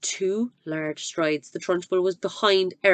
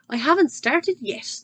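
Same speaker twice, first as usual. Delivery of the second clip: briskly; 7 semitones higher than usual. Acted. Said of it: "the Trunchbull said briskly".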